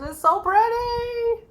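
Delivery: a drawn-out, high delighted coo; delighted squeal. Real, not acted. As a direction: swoon over the colors. Admiring colorful yarn.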